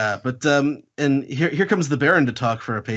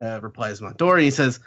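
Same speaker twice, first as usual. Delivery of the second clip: ordinary talk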